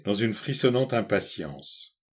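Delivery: ordinary delivery, nothing marked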